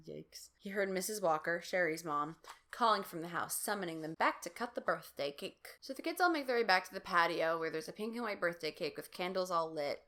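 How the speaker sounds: ordinary talk, nothing marked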